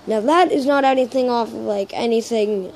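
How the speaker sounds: ordinary delivery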